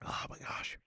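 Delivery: breathless whisper, almost no voice; whispered gasp. Real, not acted. Eating hot wings on stream, a pained whisper. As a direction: whisper it as the heat hits.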